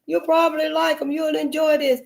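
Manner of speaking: imitating the man who offered the books; the man offering books. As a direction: play the helpful stranger lightly. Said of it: "that man gave me them books".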